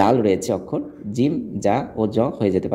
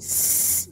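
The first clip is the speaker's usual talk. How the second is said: long, voiceless whistling s; soft sibilant held without any vowel; snake-like hiss; 7 dB quieter than usual. Shown as a demonstration. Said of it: "সাপের শীষের মতো".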